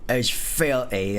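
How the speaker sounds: slow, stretched mock Cockney; Cockney British voice. Showing friends the filthy word, in mock Cockney. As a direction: drag it out in put-on Cockney. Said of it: "weird Cockney British voice".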